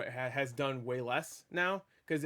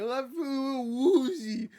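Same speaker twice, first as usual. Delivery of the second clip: very high, long-drawn mock woozy wail; Aunt May scream impression; 10 semitones higher, 3 dB louder, much slower than usual. Imitating a woman staggering up from a hit, woozy scream. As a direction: stretch it out in a dazed falsetto. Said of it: "scream impression".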